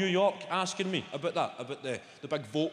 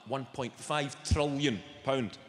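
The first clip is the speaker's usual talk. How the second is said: slow, weighty delivery of the number; slow emphasis; 3 semitones lower, slower than usual. Acted. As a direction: stretch each word to show how huge. Drawing out a huge number.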